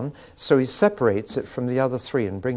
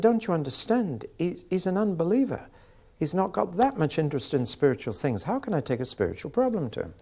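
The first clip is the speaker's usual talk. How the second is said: higher, exasperated woman's voice; raised woman's voice; 7 semitones higher than usual. Retold or imitated. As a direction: mimic her disbelief, pitched up. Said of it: "She said".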